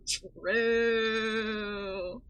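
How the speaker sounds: True! drawn out in a put-on accent, rising at the end; a long, lilting mock-accent True!. Streamer mocking the patient's accent, with a lilting drawn-out True!. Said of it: "The only accent she deserves".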